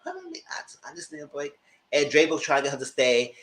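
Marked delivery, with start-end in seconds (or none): 0.1-0.4 high, soft, crying imitation; mock crying. Vlogger imitating the crying birthday girl, softly tearful and high. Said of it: "And she's crying". 0.5-1.5 whispered aside, barely voiced. Real, not acted. Vlogger confiding, in a whisper.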